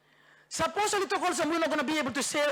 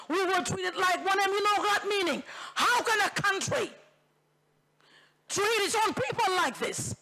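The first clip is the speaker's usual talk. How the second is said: rising anger; 6 semitones higher than usual. Real, not acted. Angry protest at the blockade.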